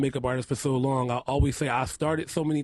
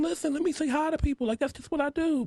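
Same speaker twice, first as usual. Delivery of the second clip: high breezy reply; 14 semitones higher than usual. Retold or imitated. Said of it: "But I'm".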